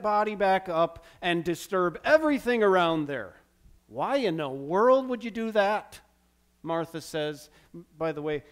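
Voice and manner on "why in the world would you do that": louder, higher disbelieving question; mock the shocked mourners' objection; incredulous objection. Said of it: "she didn't say it that way".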